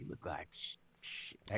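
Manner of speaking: very soft, trailing whisper; not yelled. Acted. Player starts insulting Heliodor from a distance, near whisper. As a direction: whisper it and break off mid-word. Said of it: "you have to yell that".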